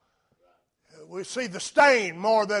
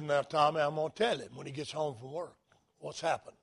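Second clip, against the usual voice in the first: gentle mother; 4 semitones lower, 8 dB quieter, faster than usual. Retold or imitated. Mother answers son gently.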